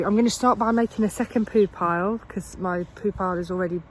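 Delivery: ordinary delivery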